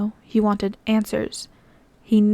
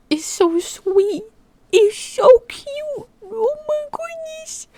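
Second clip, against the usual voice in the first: cooing; 14 semitones higher, slower than usual. Real, not acted. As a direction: coo like to a kitten. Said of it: "I feel like I'm talking to a cat".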